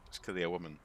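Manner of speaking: usual speaking voice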